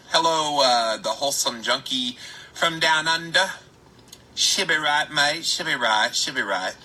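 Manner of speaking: lightly faked Australian greeting, half-joking; horrible Aussie accent. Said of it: "That's a horrible Aussie accent".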